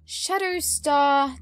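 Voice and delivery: ordinary talk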